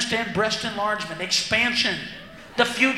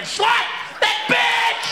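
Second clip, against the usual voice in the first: outraged shout; 11 semitones higher, much slower than usual. Real, not acted. Outraged for fan.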